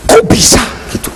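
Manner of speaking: high breathy voice of a doubter; whispered skeptic. Pretend. Preacher voicing a skeptical listener in a whisper.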